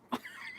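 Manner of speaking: soft laugh after the punchline. Real, not acted. Mother amused by son's answer, laughing. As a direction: laugh quietly at your own story.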